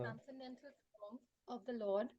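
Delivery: faint, distant voice on a bad mic; barely audible reading, far from the mic. Real, not acted. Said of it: "We can't hear you".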